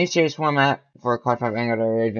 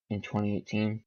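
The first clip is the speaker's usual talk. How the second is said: usual speaking voice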